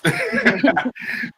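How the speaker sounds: high burst of real laughter; big laugh. Real, not acted. Actor laughs at his own joke to the panel, a big real laugh. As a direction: let a hearty laugh break out.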